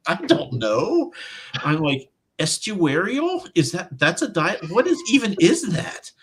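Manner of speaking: high-pitched, drawn-out mock bewilderment; baffled. Author retelling being asked which dialect she wants, baffled. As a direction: act out being utterly stumped by a strange word.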